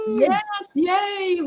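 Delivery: high-pitched, delighted cheer; excited high hurrah; real cheer. Real, not acted. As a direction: celebrate the milestone. Cheering the first year open.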